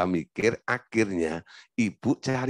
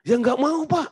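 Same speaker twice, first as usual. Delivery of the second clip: distressed wife; 13 semitones higher than usual. Retold or imitated. Wife refusing divorce, voiced.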